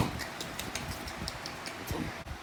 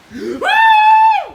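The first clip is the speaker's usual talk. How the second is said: very high, loud screaming gasp; a piercing shocked gasp; shrieking in shock; shrill gasp; 7 dB louder than usual. Acted. Huge startled gasp.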